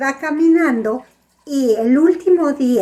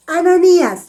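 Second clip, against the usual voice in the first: higher, louder call of a name; 6 semitones higher, 6 dB louder than usual. Acted. God calls the disciple Ananías in a vision, raised calling voice. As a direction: summon someone by name in a storyteller's raised voice.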